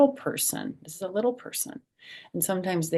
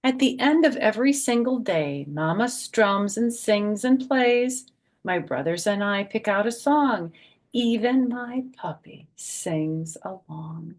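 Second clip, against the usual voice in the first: higher, louder sing-song storybook read; bouncy rhyming picture-book voice; reading to children; 5 semitones higher, 6 dB louder than usual. Acted. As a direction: read the rhyme aloud to a group of little kids. Reads rhyming picture book aloud.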